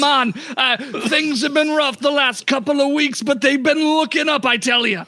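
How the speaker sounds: lightly hammed Jamaican lilt; Jamaican accent. Shopkeeper telling the party about rough weeks, Jamaican accent. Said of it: "I don't even know if I can do a Jamaican accent".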